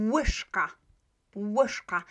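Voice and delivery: "Łyżka" with an English w at the start; pronunciation demo. Shown as a demonstration. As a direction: model "Łyżka" as WISH-ka. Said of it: "it's always pronounced in this way".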